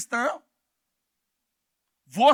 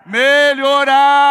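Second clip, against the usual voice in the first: stretched-out loud call; drawn-out shout; slow loud shout; 4 semitones higher, 12 dB louder, much slower than usual. Shown as a demonstration. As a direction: drag the word out loud. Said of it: "Fala alto aí".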